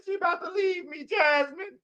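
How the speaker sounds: high, whiny mock sob; imitating crying friend. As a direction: wail it like a heartbroken friend. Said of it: "sitting there crying with the pillow".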